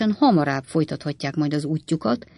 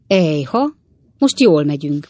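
pleased, upbeat exclamation, louder; lively, eager old man; 5 dB louder than usual. A narrator's reading. Old Wardle cheering his friend in the coach, excited. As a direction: cheer that they're finally going fast. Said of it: "mondta az öreg Wardle lelkesen".

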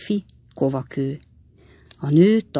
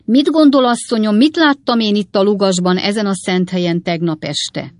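raised, loud solemn announcement; 4 semitones higher, 5 dB louder than usual. A narrator's reading. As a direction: open high and loud, settling into a solemn question. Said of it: "a dagadt fiú ünnepélyes hangja".

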